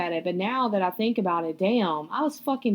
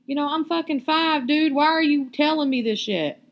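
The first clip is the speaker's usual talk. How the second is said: higher, louder exasperated comeback; mock-challenge dad with raised pitch and volume; indignant retort; 7 semitones higher, 5 dB louder than usual. Child's indignant retort to drunk dad.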